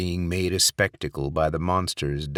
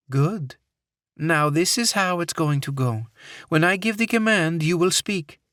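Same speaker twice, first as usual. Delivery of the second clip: put-on commanding woman, Middle Eastern accent; 11 semitones higher, 3 dB louder than usual. Captor gives orders.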